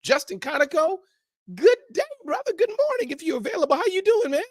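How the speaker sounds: very high, floating sing-song hello; sing-song greeting. Acted. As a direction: croon the welcome up high. Greeting guest theatrically.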